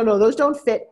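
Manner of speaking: ordinary talk, nothing marked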